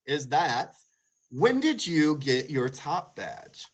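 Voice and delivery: ordinary delivery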